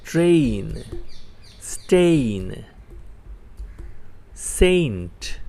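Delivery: teacher reading a word list aloud for students; word drill. Acted. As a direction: call each word out for the class to repeat.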